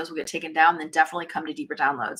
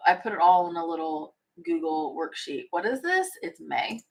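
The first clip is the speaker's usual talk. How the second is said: normal talking voice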